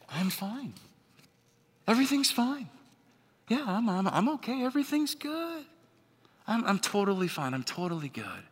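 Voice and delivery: high, fake bright reassurance; forced cheerfulness. Preacher acting someone hiding pain, high and falsely cheerful. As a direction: put on a brave fake smile.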